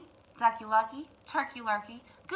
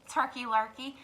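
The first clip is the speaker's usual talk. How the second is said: ordinary delivery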